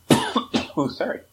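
coughing through the words; a cough breaking into speech; coughing fit. Real, not acted. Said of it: "Now I'm coughing".

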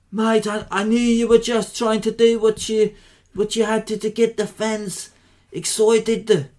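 bad South African accent. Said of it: "It's one of my worst voices".

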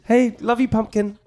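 high cheesy lovey voice; mock sweet talk. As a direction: send up a sappy couple. Mocking cheesy pet names.

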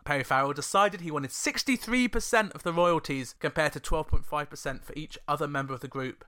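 ordinary talk, nothing marked

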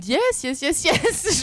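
English yeses shooting up high; self-mocking high-pitched English; voice climbing in English. Shown as a demonstration. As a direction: let each yes climb into a squeak. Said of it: "voix qui monte de trois octaves au-dessus".

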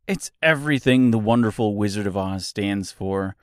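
normal talking voice

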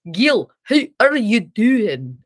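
slow, overdone mock Scottish; exaggerated, stretched-out fake Scottish; bad Scottish accent. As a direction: imitate English friends butchering a Scottish accent. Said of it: "try to do a Scottish accent".

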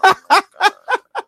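high, loud burst of laughter; laughing. Real, not acted. Host joking that Hitman got got, laughing. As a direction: cackle hard at your own joke.

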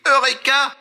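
high-pitched eureka shout; mock triumphant cry. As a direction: cry it out like a sudden great discovery. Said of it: "et je crie".

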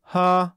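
single sleepy noise, demonstrated on purpose; drifting-off sound. Shown as a demonstration. Host showing the sound made when drifting off, demonstrated huh. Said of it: "I will often make this sound".

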